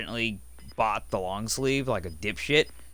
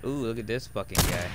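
normal talking voice

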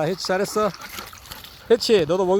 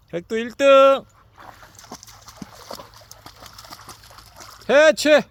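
calling out; 6 semitones higher, 4 dB quieter than usual. Real, not acted. Calling dogs from afar.

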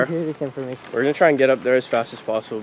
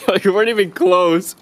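teasing; 7 semitones higher, 4 dB louder than usual. Real, not acted. Teasing friend after miss.

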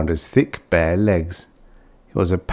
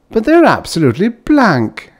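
hesitant; 13 semitones higher, 7 dB louder than usual. A narrator's reading. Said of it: "the uncle began hesitantly".

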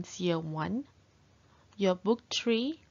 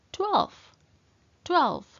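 excited; 9 semitones higher, 6 dB louder than usual. Acted. Counting climax, big jump.

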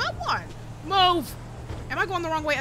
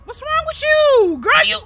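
shocked yell; 13 semitones higher, 9 dB louder than usual. Real, not acted. Passenger girl attacks him in car.

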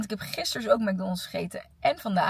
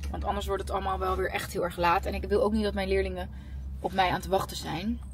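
ordinary talk

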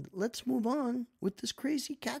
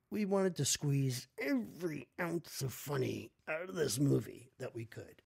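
normal talking voice

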